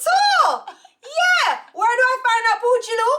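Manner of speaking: high, loud teasing squeal; hammed-up gushing follower voice; mock fans. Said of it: "people are like".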